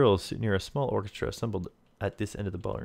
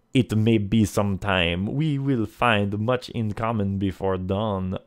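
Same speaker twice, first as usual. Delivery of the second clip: put-on French-accented villain; 4 dB louder than usual. Lafond summons player to supper, French accent. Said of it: "he asks in a light French accent".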